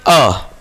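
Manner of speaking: अ drawn deep from the throat; teacher voices अ to show the throat; throat vowel demo. Shown as a demonstration. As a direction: sound अ low from the throat. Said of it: "अ का उच्चारण करते हैं".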